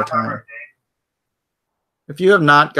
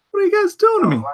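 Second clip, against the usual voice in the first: high squeaky exasperation; faster than usual. Real, not acted. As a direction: squeal it much higher. Repeats reaction, much higher.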